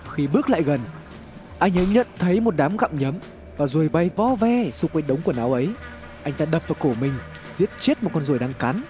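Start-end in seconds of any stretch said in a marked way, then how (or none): none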